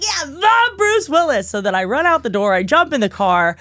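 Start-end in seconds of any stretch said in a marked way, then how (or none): 0.0-1.5 high slow stress on the; slow emphatic proud reply; proud emphasis. Retold or imitated. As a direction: lean on "the" with pride. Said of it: "And I was like".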